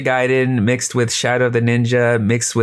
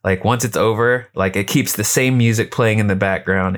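usual speaking voice

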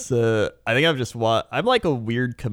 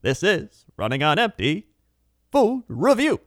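higher, lilting food-show greeting; reviewer intonation imitation; 5 semitones higher than usual. Showing friend how the reviewer speaks, lilting intonation. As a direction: imitate the reviewer's singsong intro. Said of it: "I do that same thing where I'm like".

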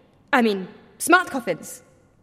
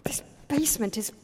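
soft cold murmur; 8 dB quieter than usual. Acted. Shivering in cold basement.

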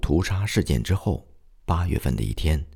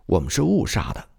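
mild narrated shout, pitched up; 5 semitones higher than usual. A narrator's reading. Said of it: "喊着说".

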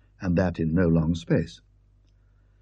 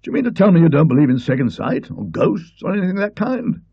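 put-on astonished, louder old Colonel; 8 semitones higher, 4 dB louder than usual. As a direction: bluff man taken aback. Colonel voiced by the narrator.

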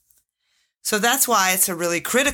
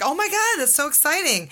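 much higher, squealing with delight; gushing excitement; 8 semitones higher than usual. Retold or imitated. HR manager reacting to an employee's pregnancy, squealing with excitement. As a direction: burst out thrilled at the news. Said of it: "all up in your business excited".

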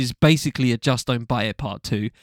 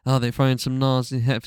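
normal talking voice

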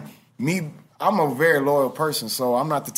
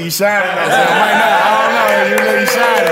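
excited praise; 6 semitones higher, 8 dB louder than usual. Real, not acted. Hyping up host's shine.

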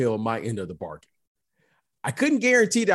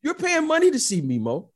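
same line again, pitched much higher to drive it home; emphatic repeat; 10 semitones higher than usual. Real, not acted. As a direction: lift your voice high on money to hammer the point.

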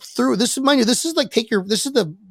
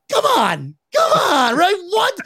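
much higher whining come on; exasperated protest; 10 semitones higher, slower than usual. Real, not acted. Reacting to the goat ban, exasperated whine. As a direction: plead against the absurd rule. Outrage at goat being banned.

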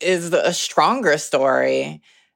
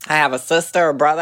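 ordinary talk

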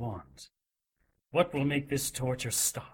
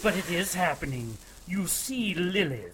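gloating shout; 5 semitones higher than usual. Acted. Cleric gloats while whipping.

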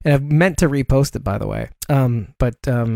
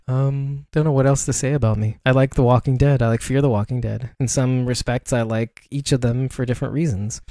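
usual speaking voice

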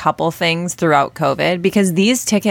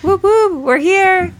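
excited cheer; 5 dB louder, much slower than usual. Real, not acted. Cheering as recording starts.

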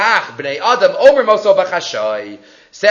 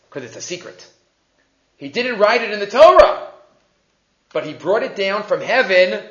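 ordinary talk

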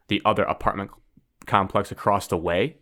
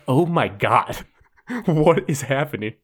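higher-pitched retold disbelief; retold shock; 5 semitones higher, slower than usual. Telling friends about seeing police with rifles, retold shock. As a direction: act out being stunned, with a laugh breaking in.

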